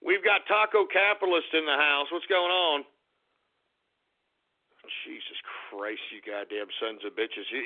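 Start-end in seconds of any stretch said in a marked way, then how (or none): 5.1-7.6 low, much softer grumbled curse; muttered mock disgust. Streamer grumbling at chat's silly names, soft mock disgust. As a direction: mutter it under your breath in mock despair.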